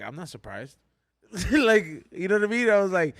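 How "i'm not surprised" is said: low, soft muttered aside; private inner thought. Retold or imitated. Friend privately reacting to DJ's DUI news, quiet inner thought. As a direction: say it quietly, as if only thinking it. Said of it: "in my head".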